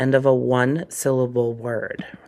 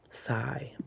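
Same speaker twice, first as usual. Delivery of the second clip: unvoiced whisper of "Sigh"; 11 dB quieter than usual. Shown as a demonstration. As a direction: breathe the word "Sigh" out in a whisper. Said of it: "Give me a good sigh".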